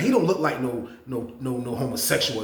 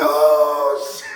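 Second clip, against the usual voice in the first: louder, laughing imitation of onlookers; laughing crowd; 7 dB louder than usual. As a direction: mimic the pod cracking up. The pod reacting to the fight.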